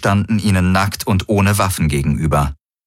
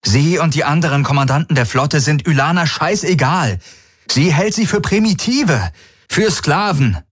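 angestrengt; 8 semitones higher than usual. A narrator's reading. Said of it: "sagte Garland angestrengt".

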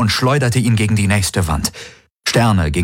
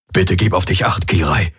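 usual speaking voice

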